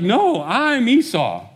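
insisting, stretching every word; insistent protest. Retold or imitated. Esau insists on who he is.

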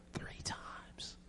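slow, barely voiced whisper; awed whisper. Son insisting to his mother, slow whisper. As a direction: whisper it for emphasis. Repeats it for effect.

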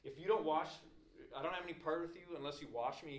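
sudden acted eagerness; excited. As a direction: plead it with excited force. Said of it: "super excited".

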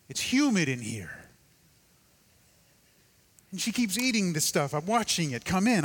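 a hint of a grumpy unborn child. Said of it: "The child looks back and goes".

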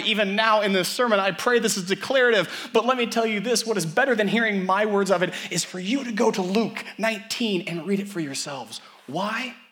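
loud, high, fast sermon climb; preaching at full force. Acted. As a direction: build the sermon to a ringing peak.

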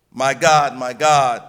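a loud, roaring cry breaking the silence. Acted. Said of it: "the silence is shattered by the screaming".

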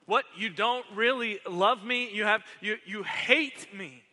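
high, loud, hurt outcry at his brother; furious yelling. Acted. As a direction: yell it as betrayed fury. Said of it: "was furious, yelling at his older brother".

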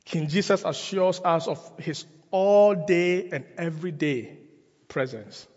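usual speaking voice

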